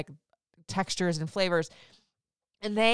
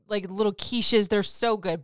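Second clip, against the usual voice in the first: ordinary talk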